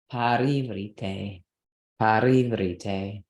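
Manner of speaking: "Parivrite" slow with stress on the first syllable; slow stressed pronunciation. Shown as a demonstration. Teacher showing where the stress falls, slowly. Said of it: "can't be that".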